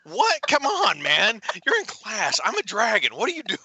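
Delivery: high, hammed-up indignant protest; mock exasperation. Mock outrage at daughter's text.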